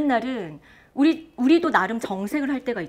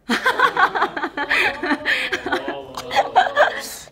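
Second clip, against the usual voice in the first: loud, high peals of laughter; long hearty laugh; 8 semitones higher, 6 dB louder than usual. Real, not acted. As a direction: burst out laughing and keep going. Laughing at friend's cutting example.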